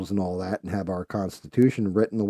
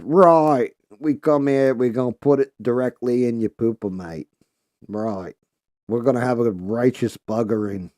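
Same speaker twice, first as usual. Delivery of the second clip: mock British accent; 3 semitones higher, 5 dB louder than usual. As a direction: play a pompous British officer threatening a visit. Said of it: "They said".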